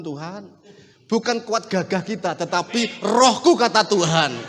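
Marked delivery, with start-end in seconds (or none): none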